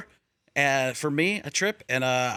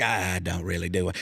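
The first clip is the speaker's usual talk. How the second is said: Matthew McConaughey impression; 12 semitones lower, 4 dB quieter than usual. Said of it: "doing a Matthew McConaughey impression".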